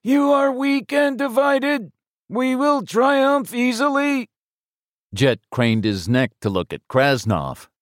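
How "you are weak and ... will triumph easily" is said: very high, slow croaking alien voice; menacing alien through a speaker; croaking metallic voice. Acted. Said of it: "a croaking metallic voice".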